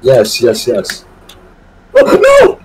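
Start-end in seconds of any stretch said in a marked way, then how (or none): 1.9-2.6 sudden high yelp; surprised. Real, not acted. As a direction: yelp out in alarm. Sudden alarm in game.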